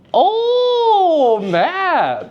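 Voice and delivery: thrilled, high-pitched praise with a slow "very good"; delighted praise. Real, not acted. Teacher cheering a student who named The Raven, excited. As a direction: cheer the student like he just won a prize. Student answers quiz correctly.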